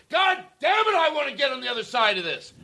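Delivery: high, straining shout of frustration; a raw, high cry of someone fighting to get clean; bigger yell. Retold or imitated. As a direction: yell it as if you're desperate to get through. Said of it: "yell it a hundred times more".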